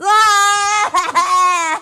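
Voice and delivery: big shouted laugh; loud laugh. Real, not acted. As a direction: let out a loud whooping laugh. Laughs loudly after shouting.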